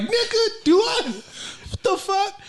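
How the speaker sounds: very high, laughing mock outrage; re-enacted startled outburst. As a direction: squeal it in acted disbelief. Re-enacting a startled reaction to someone.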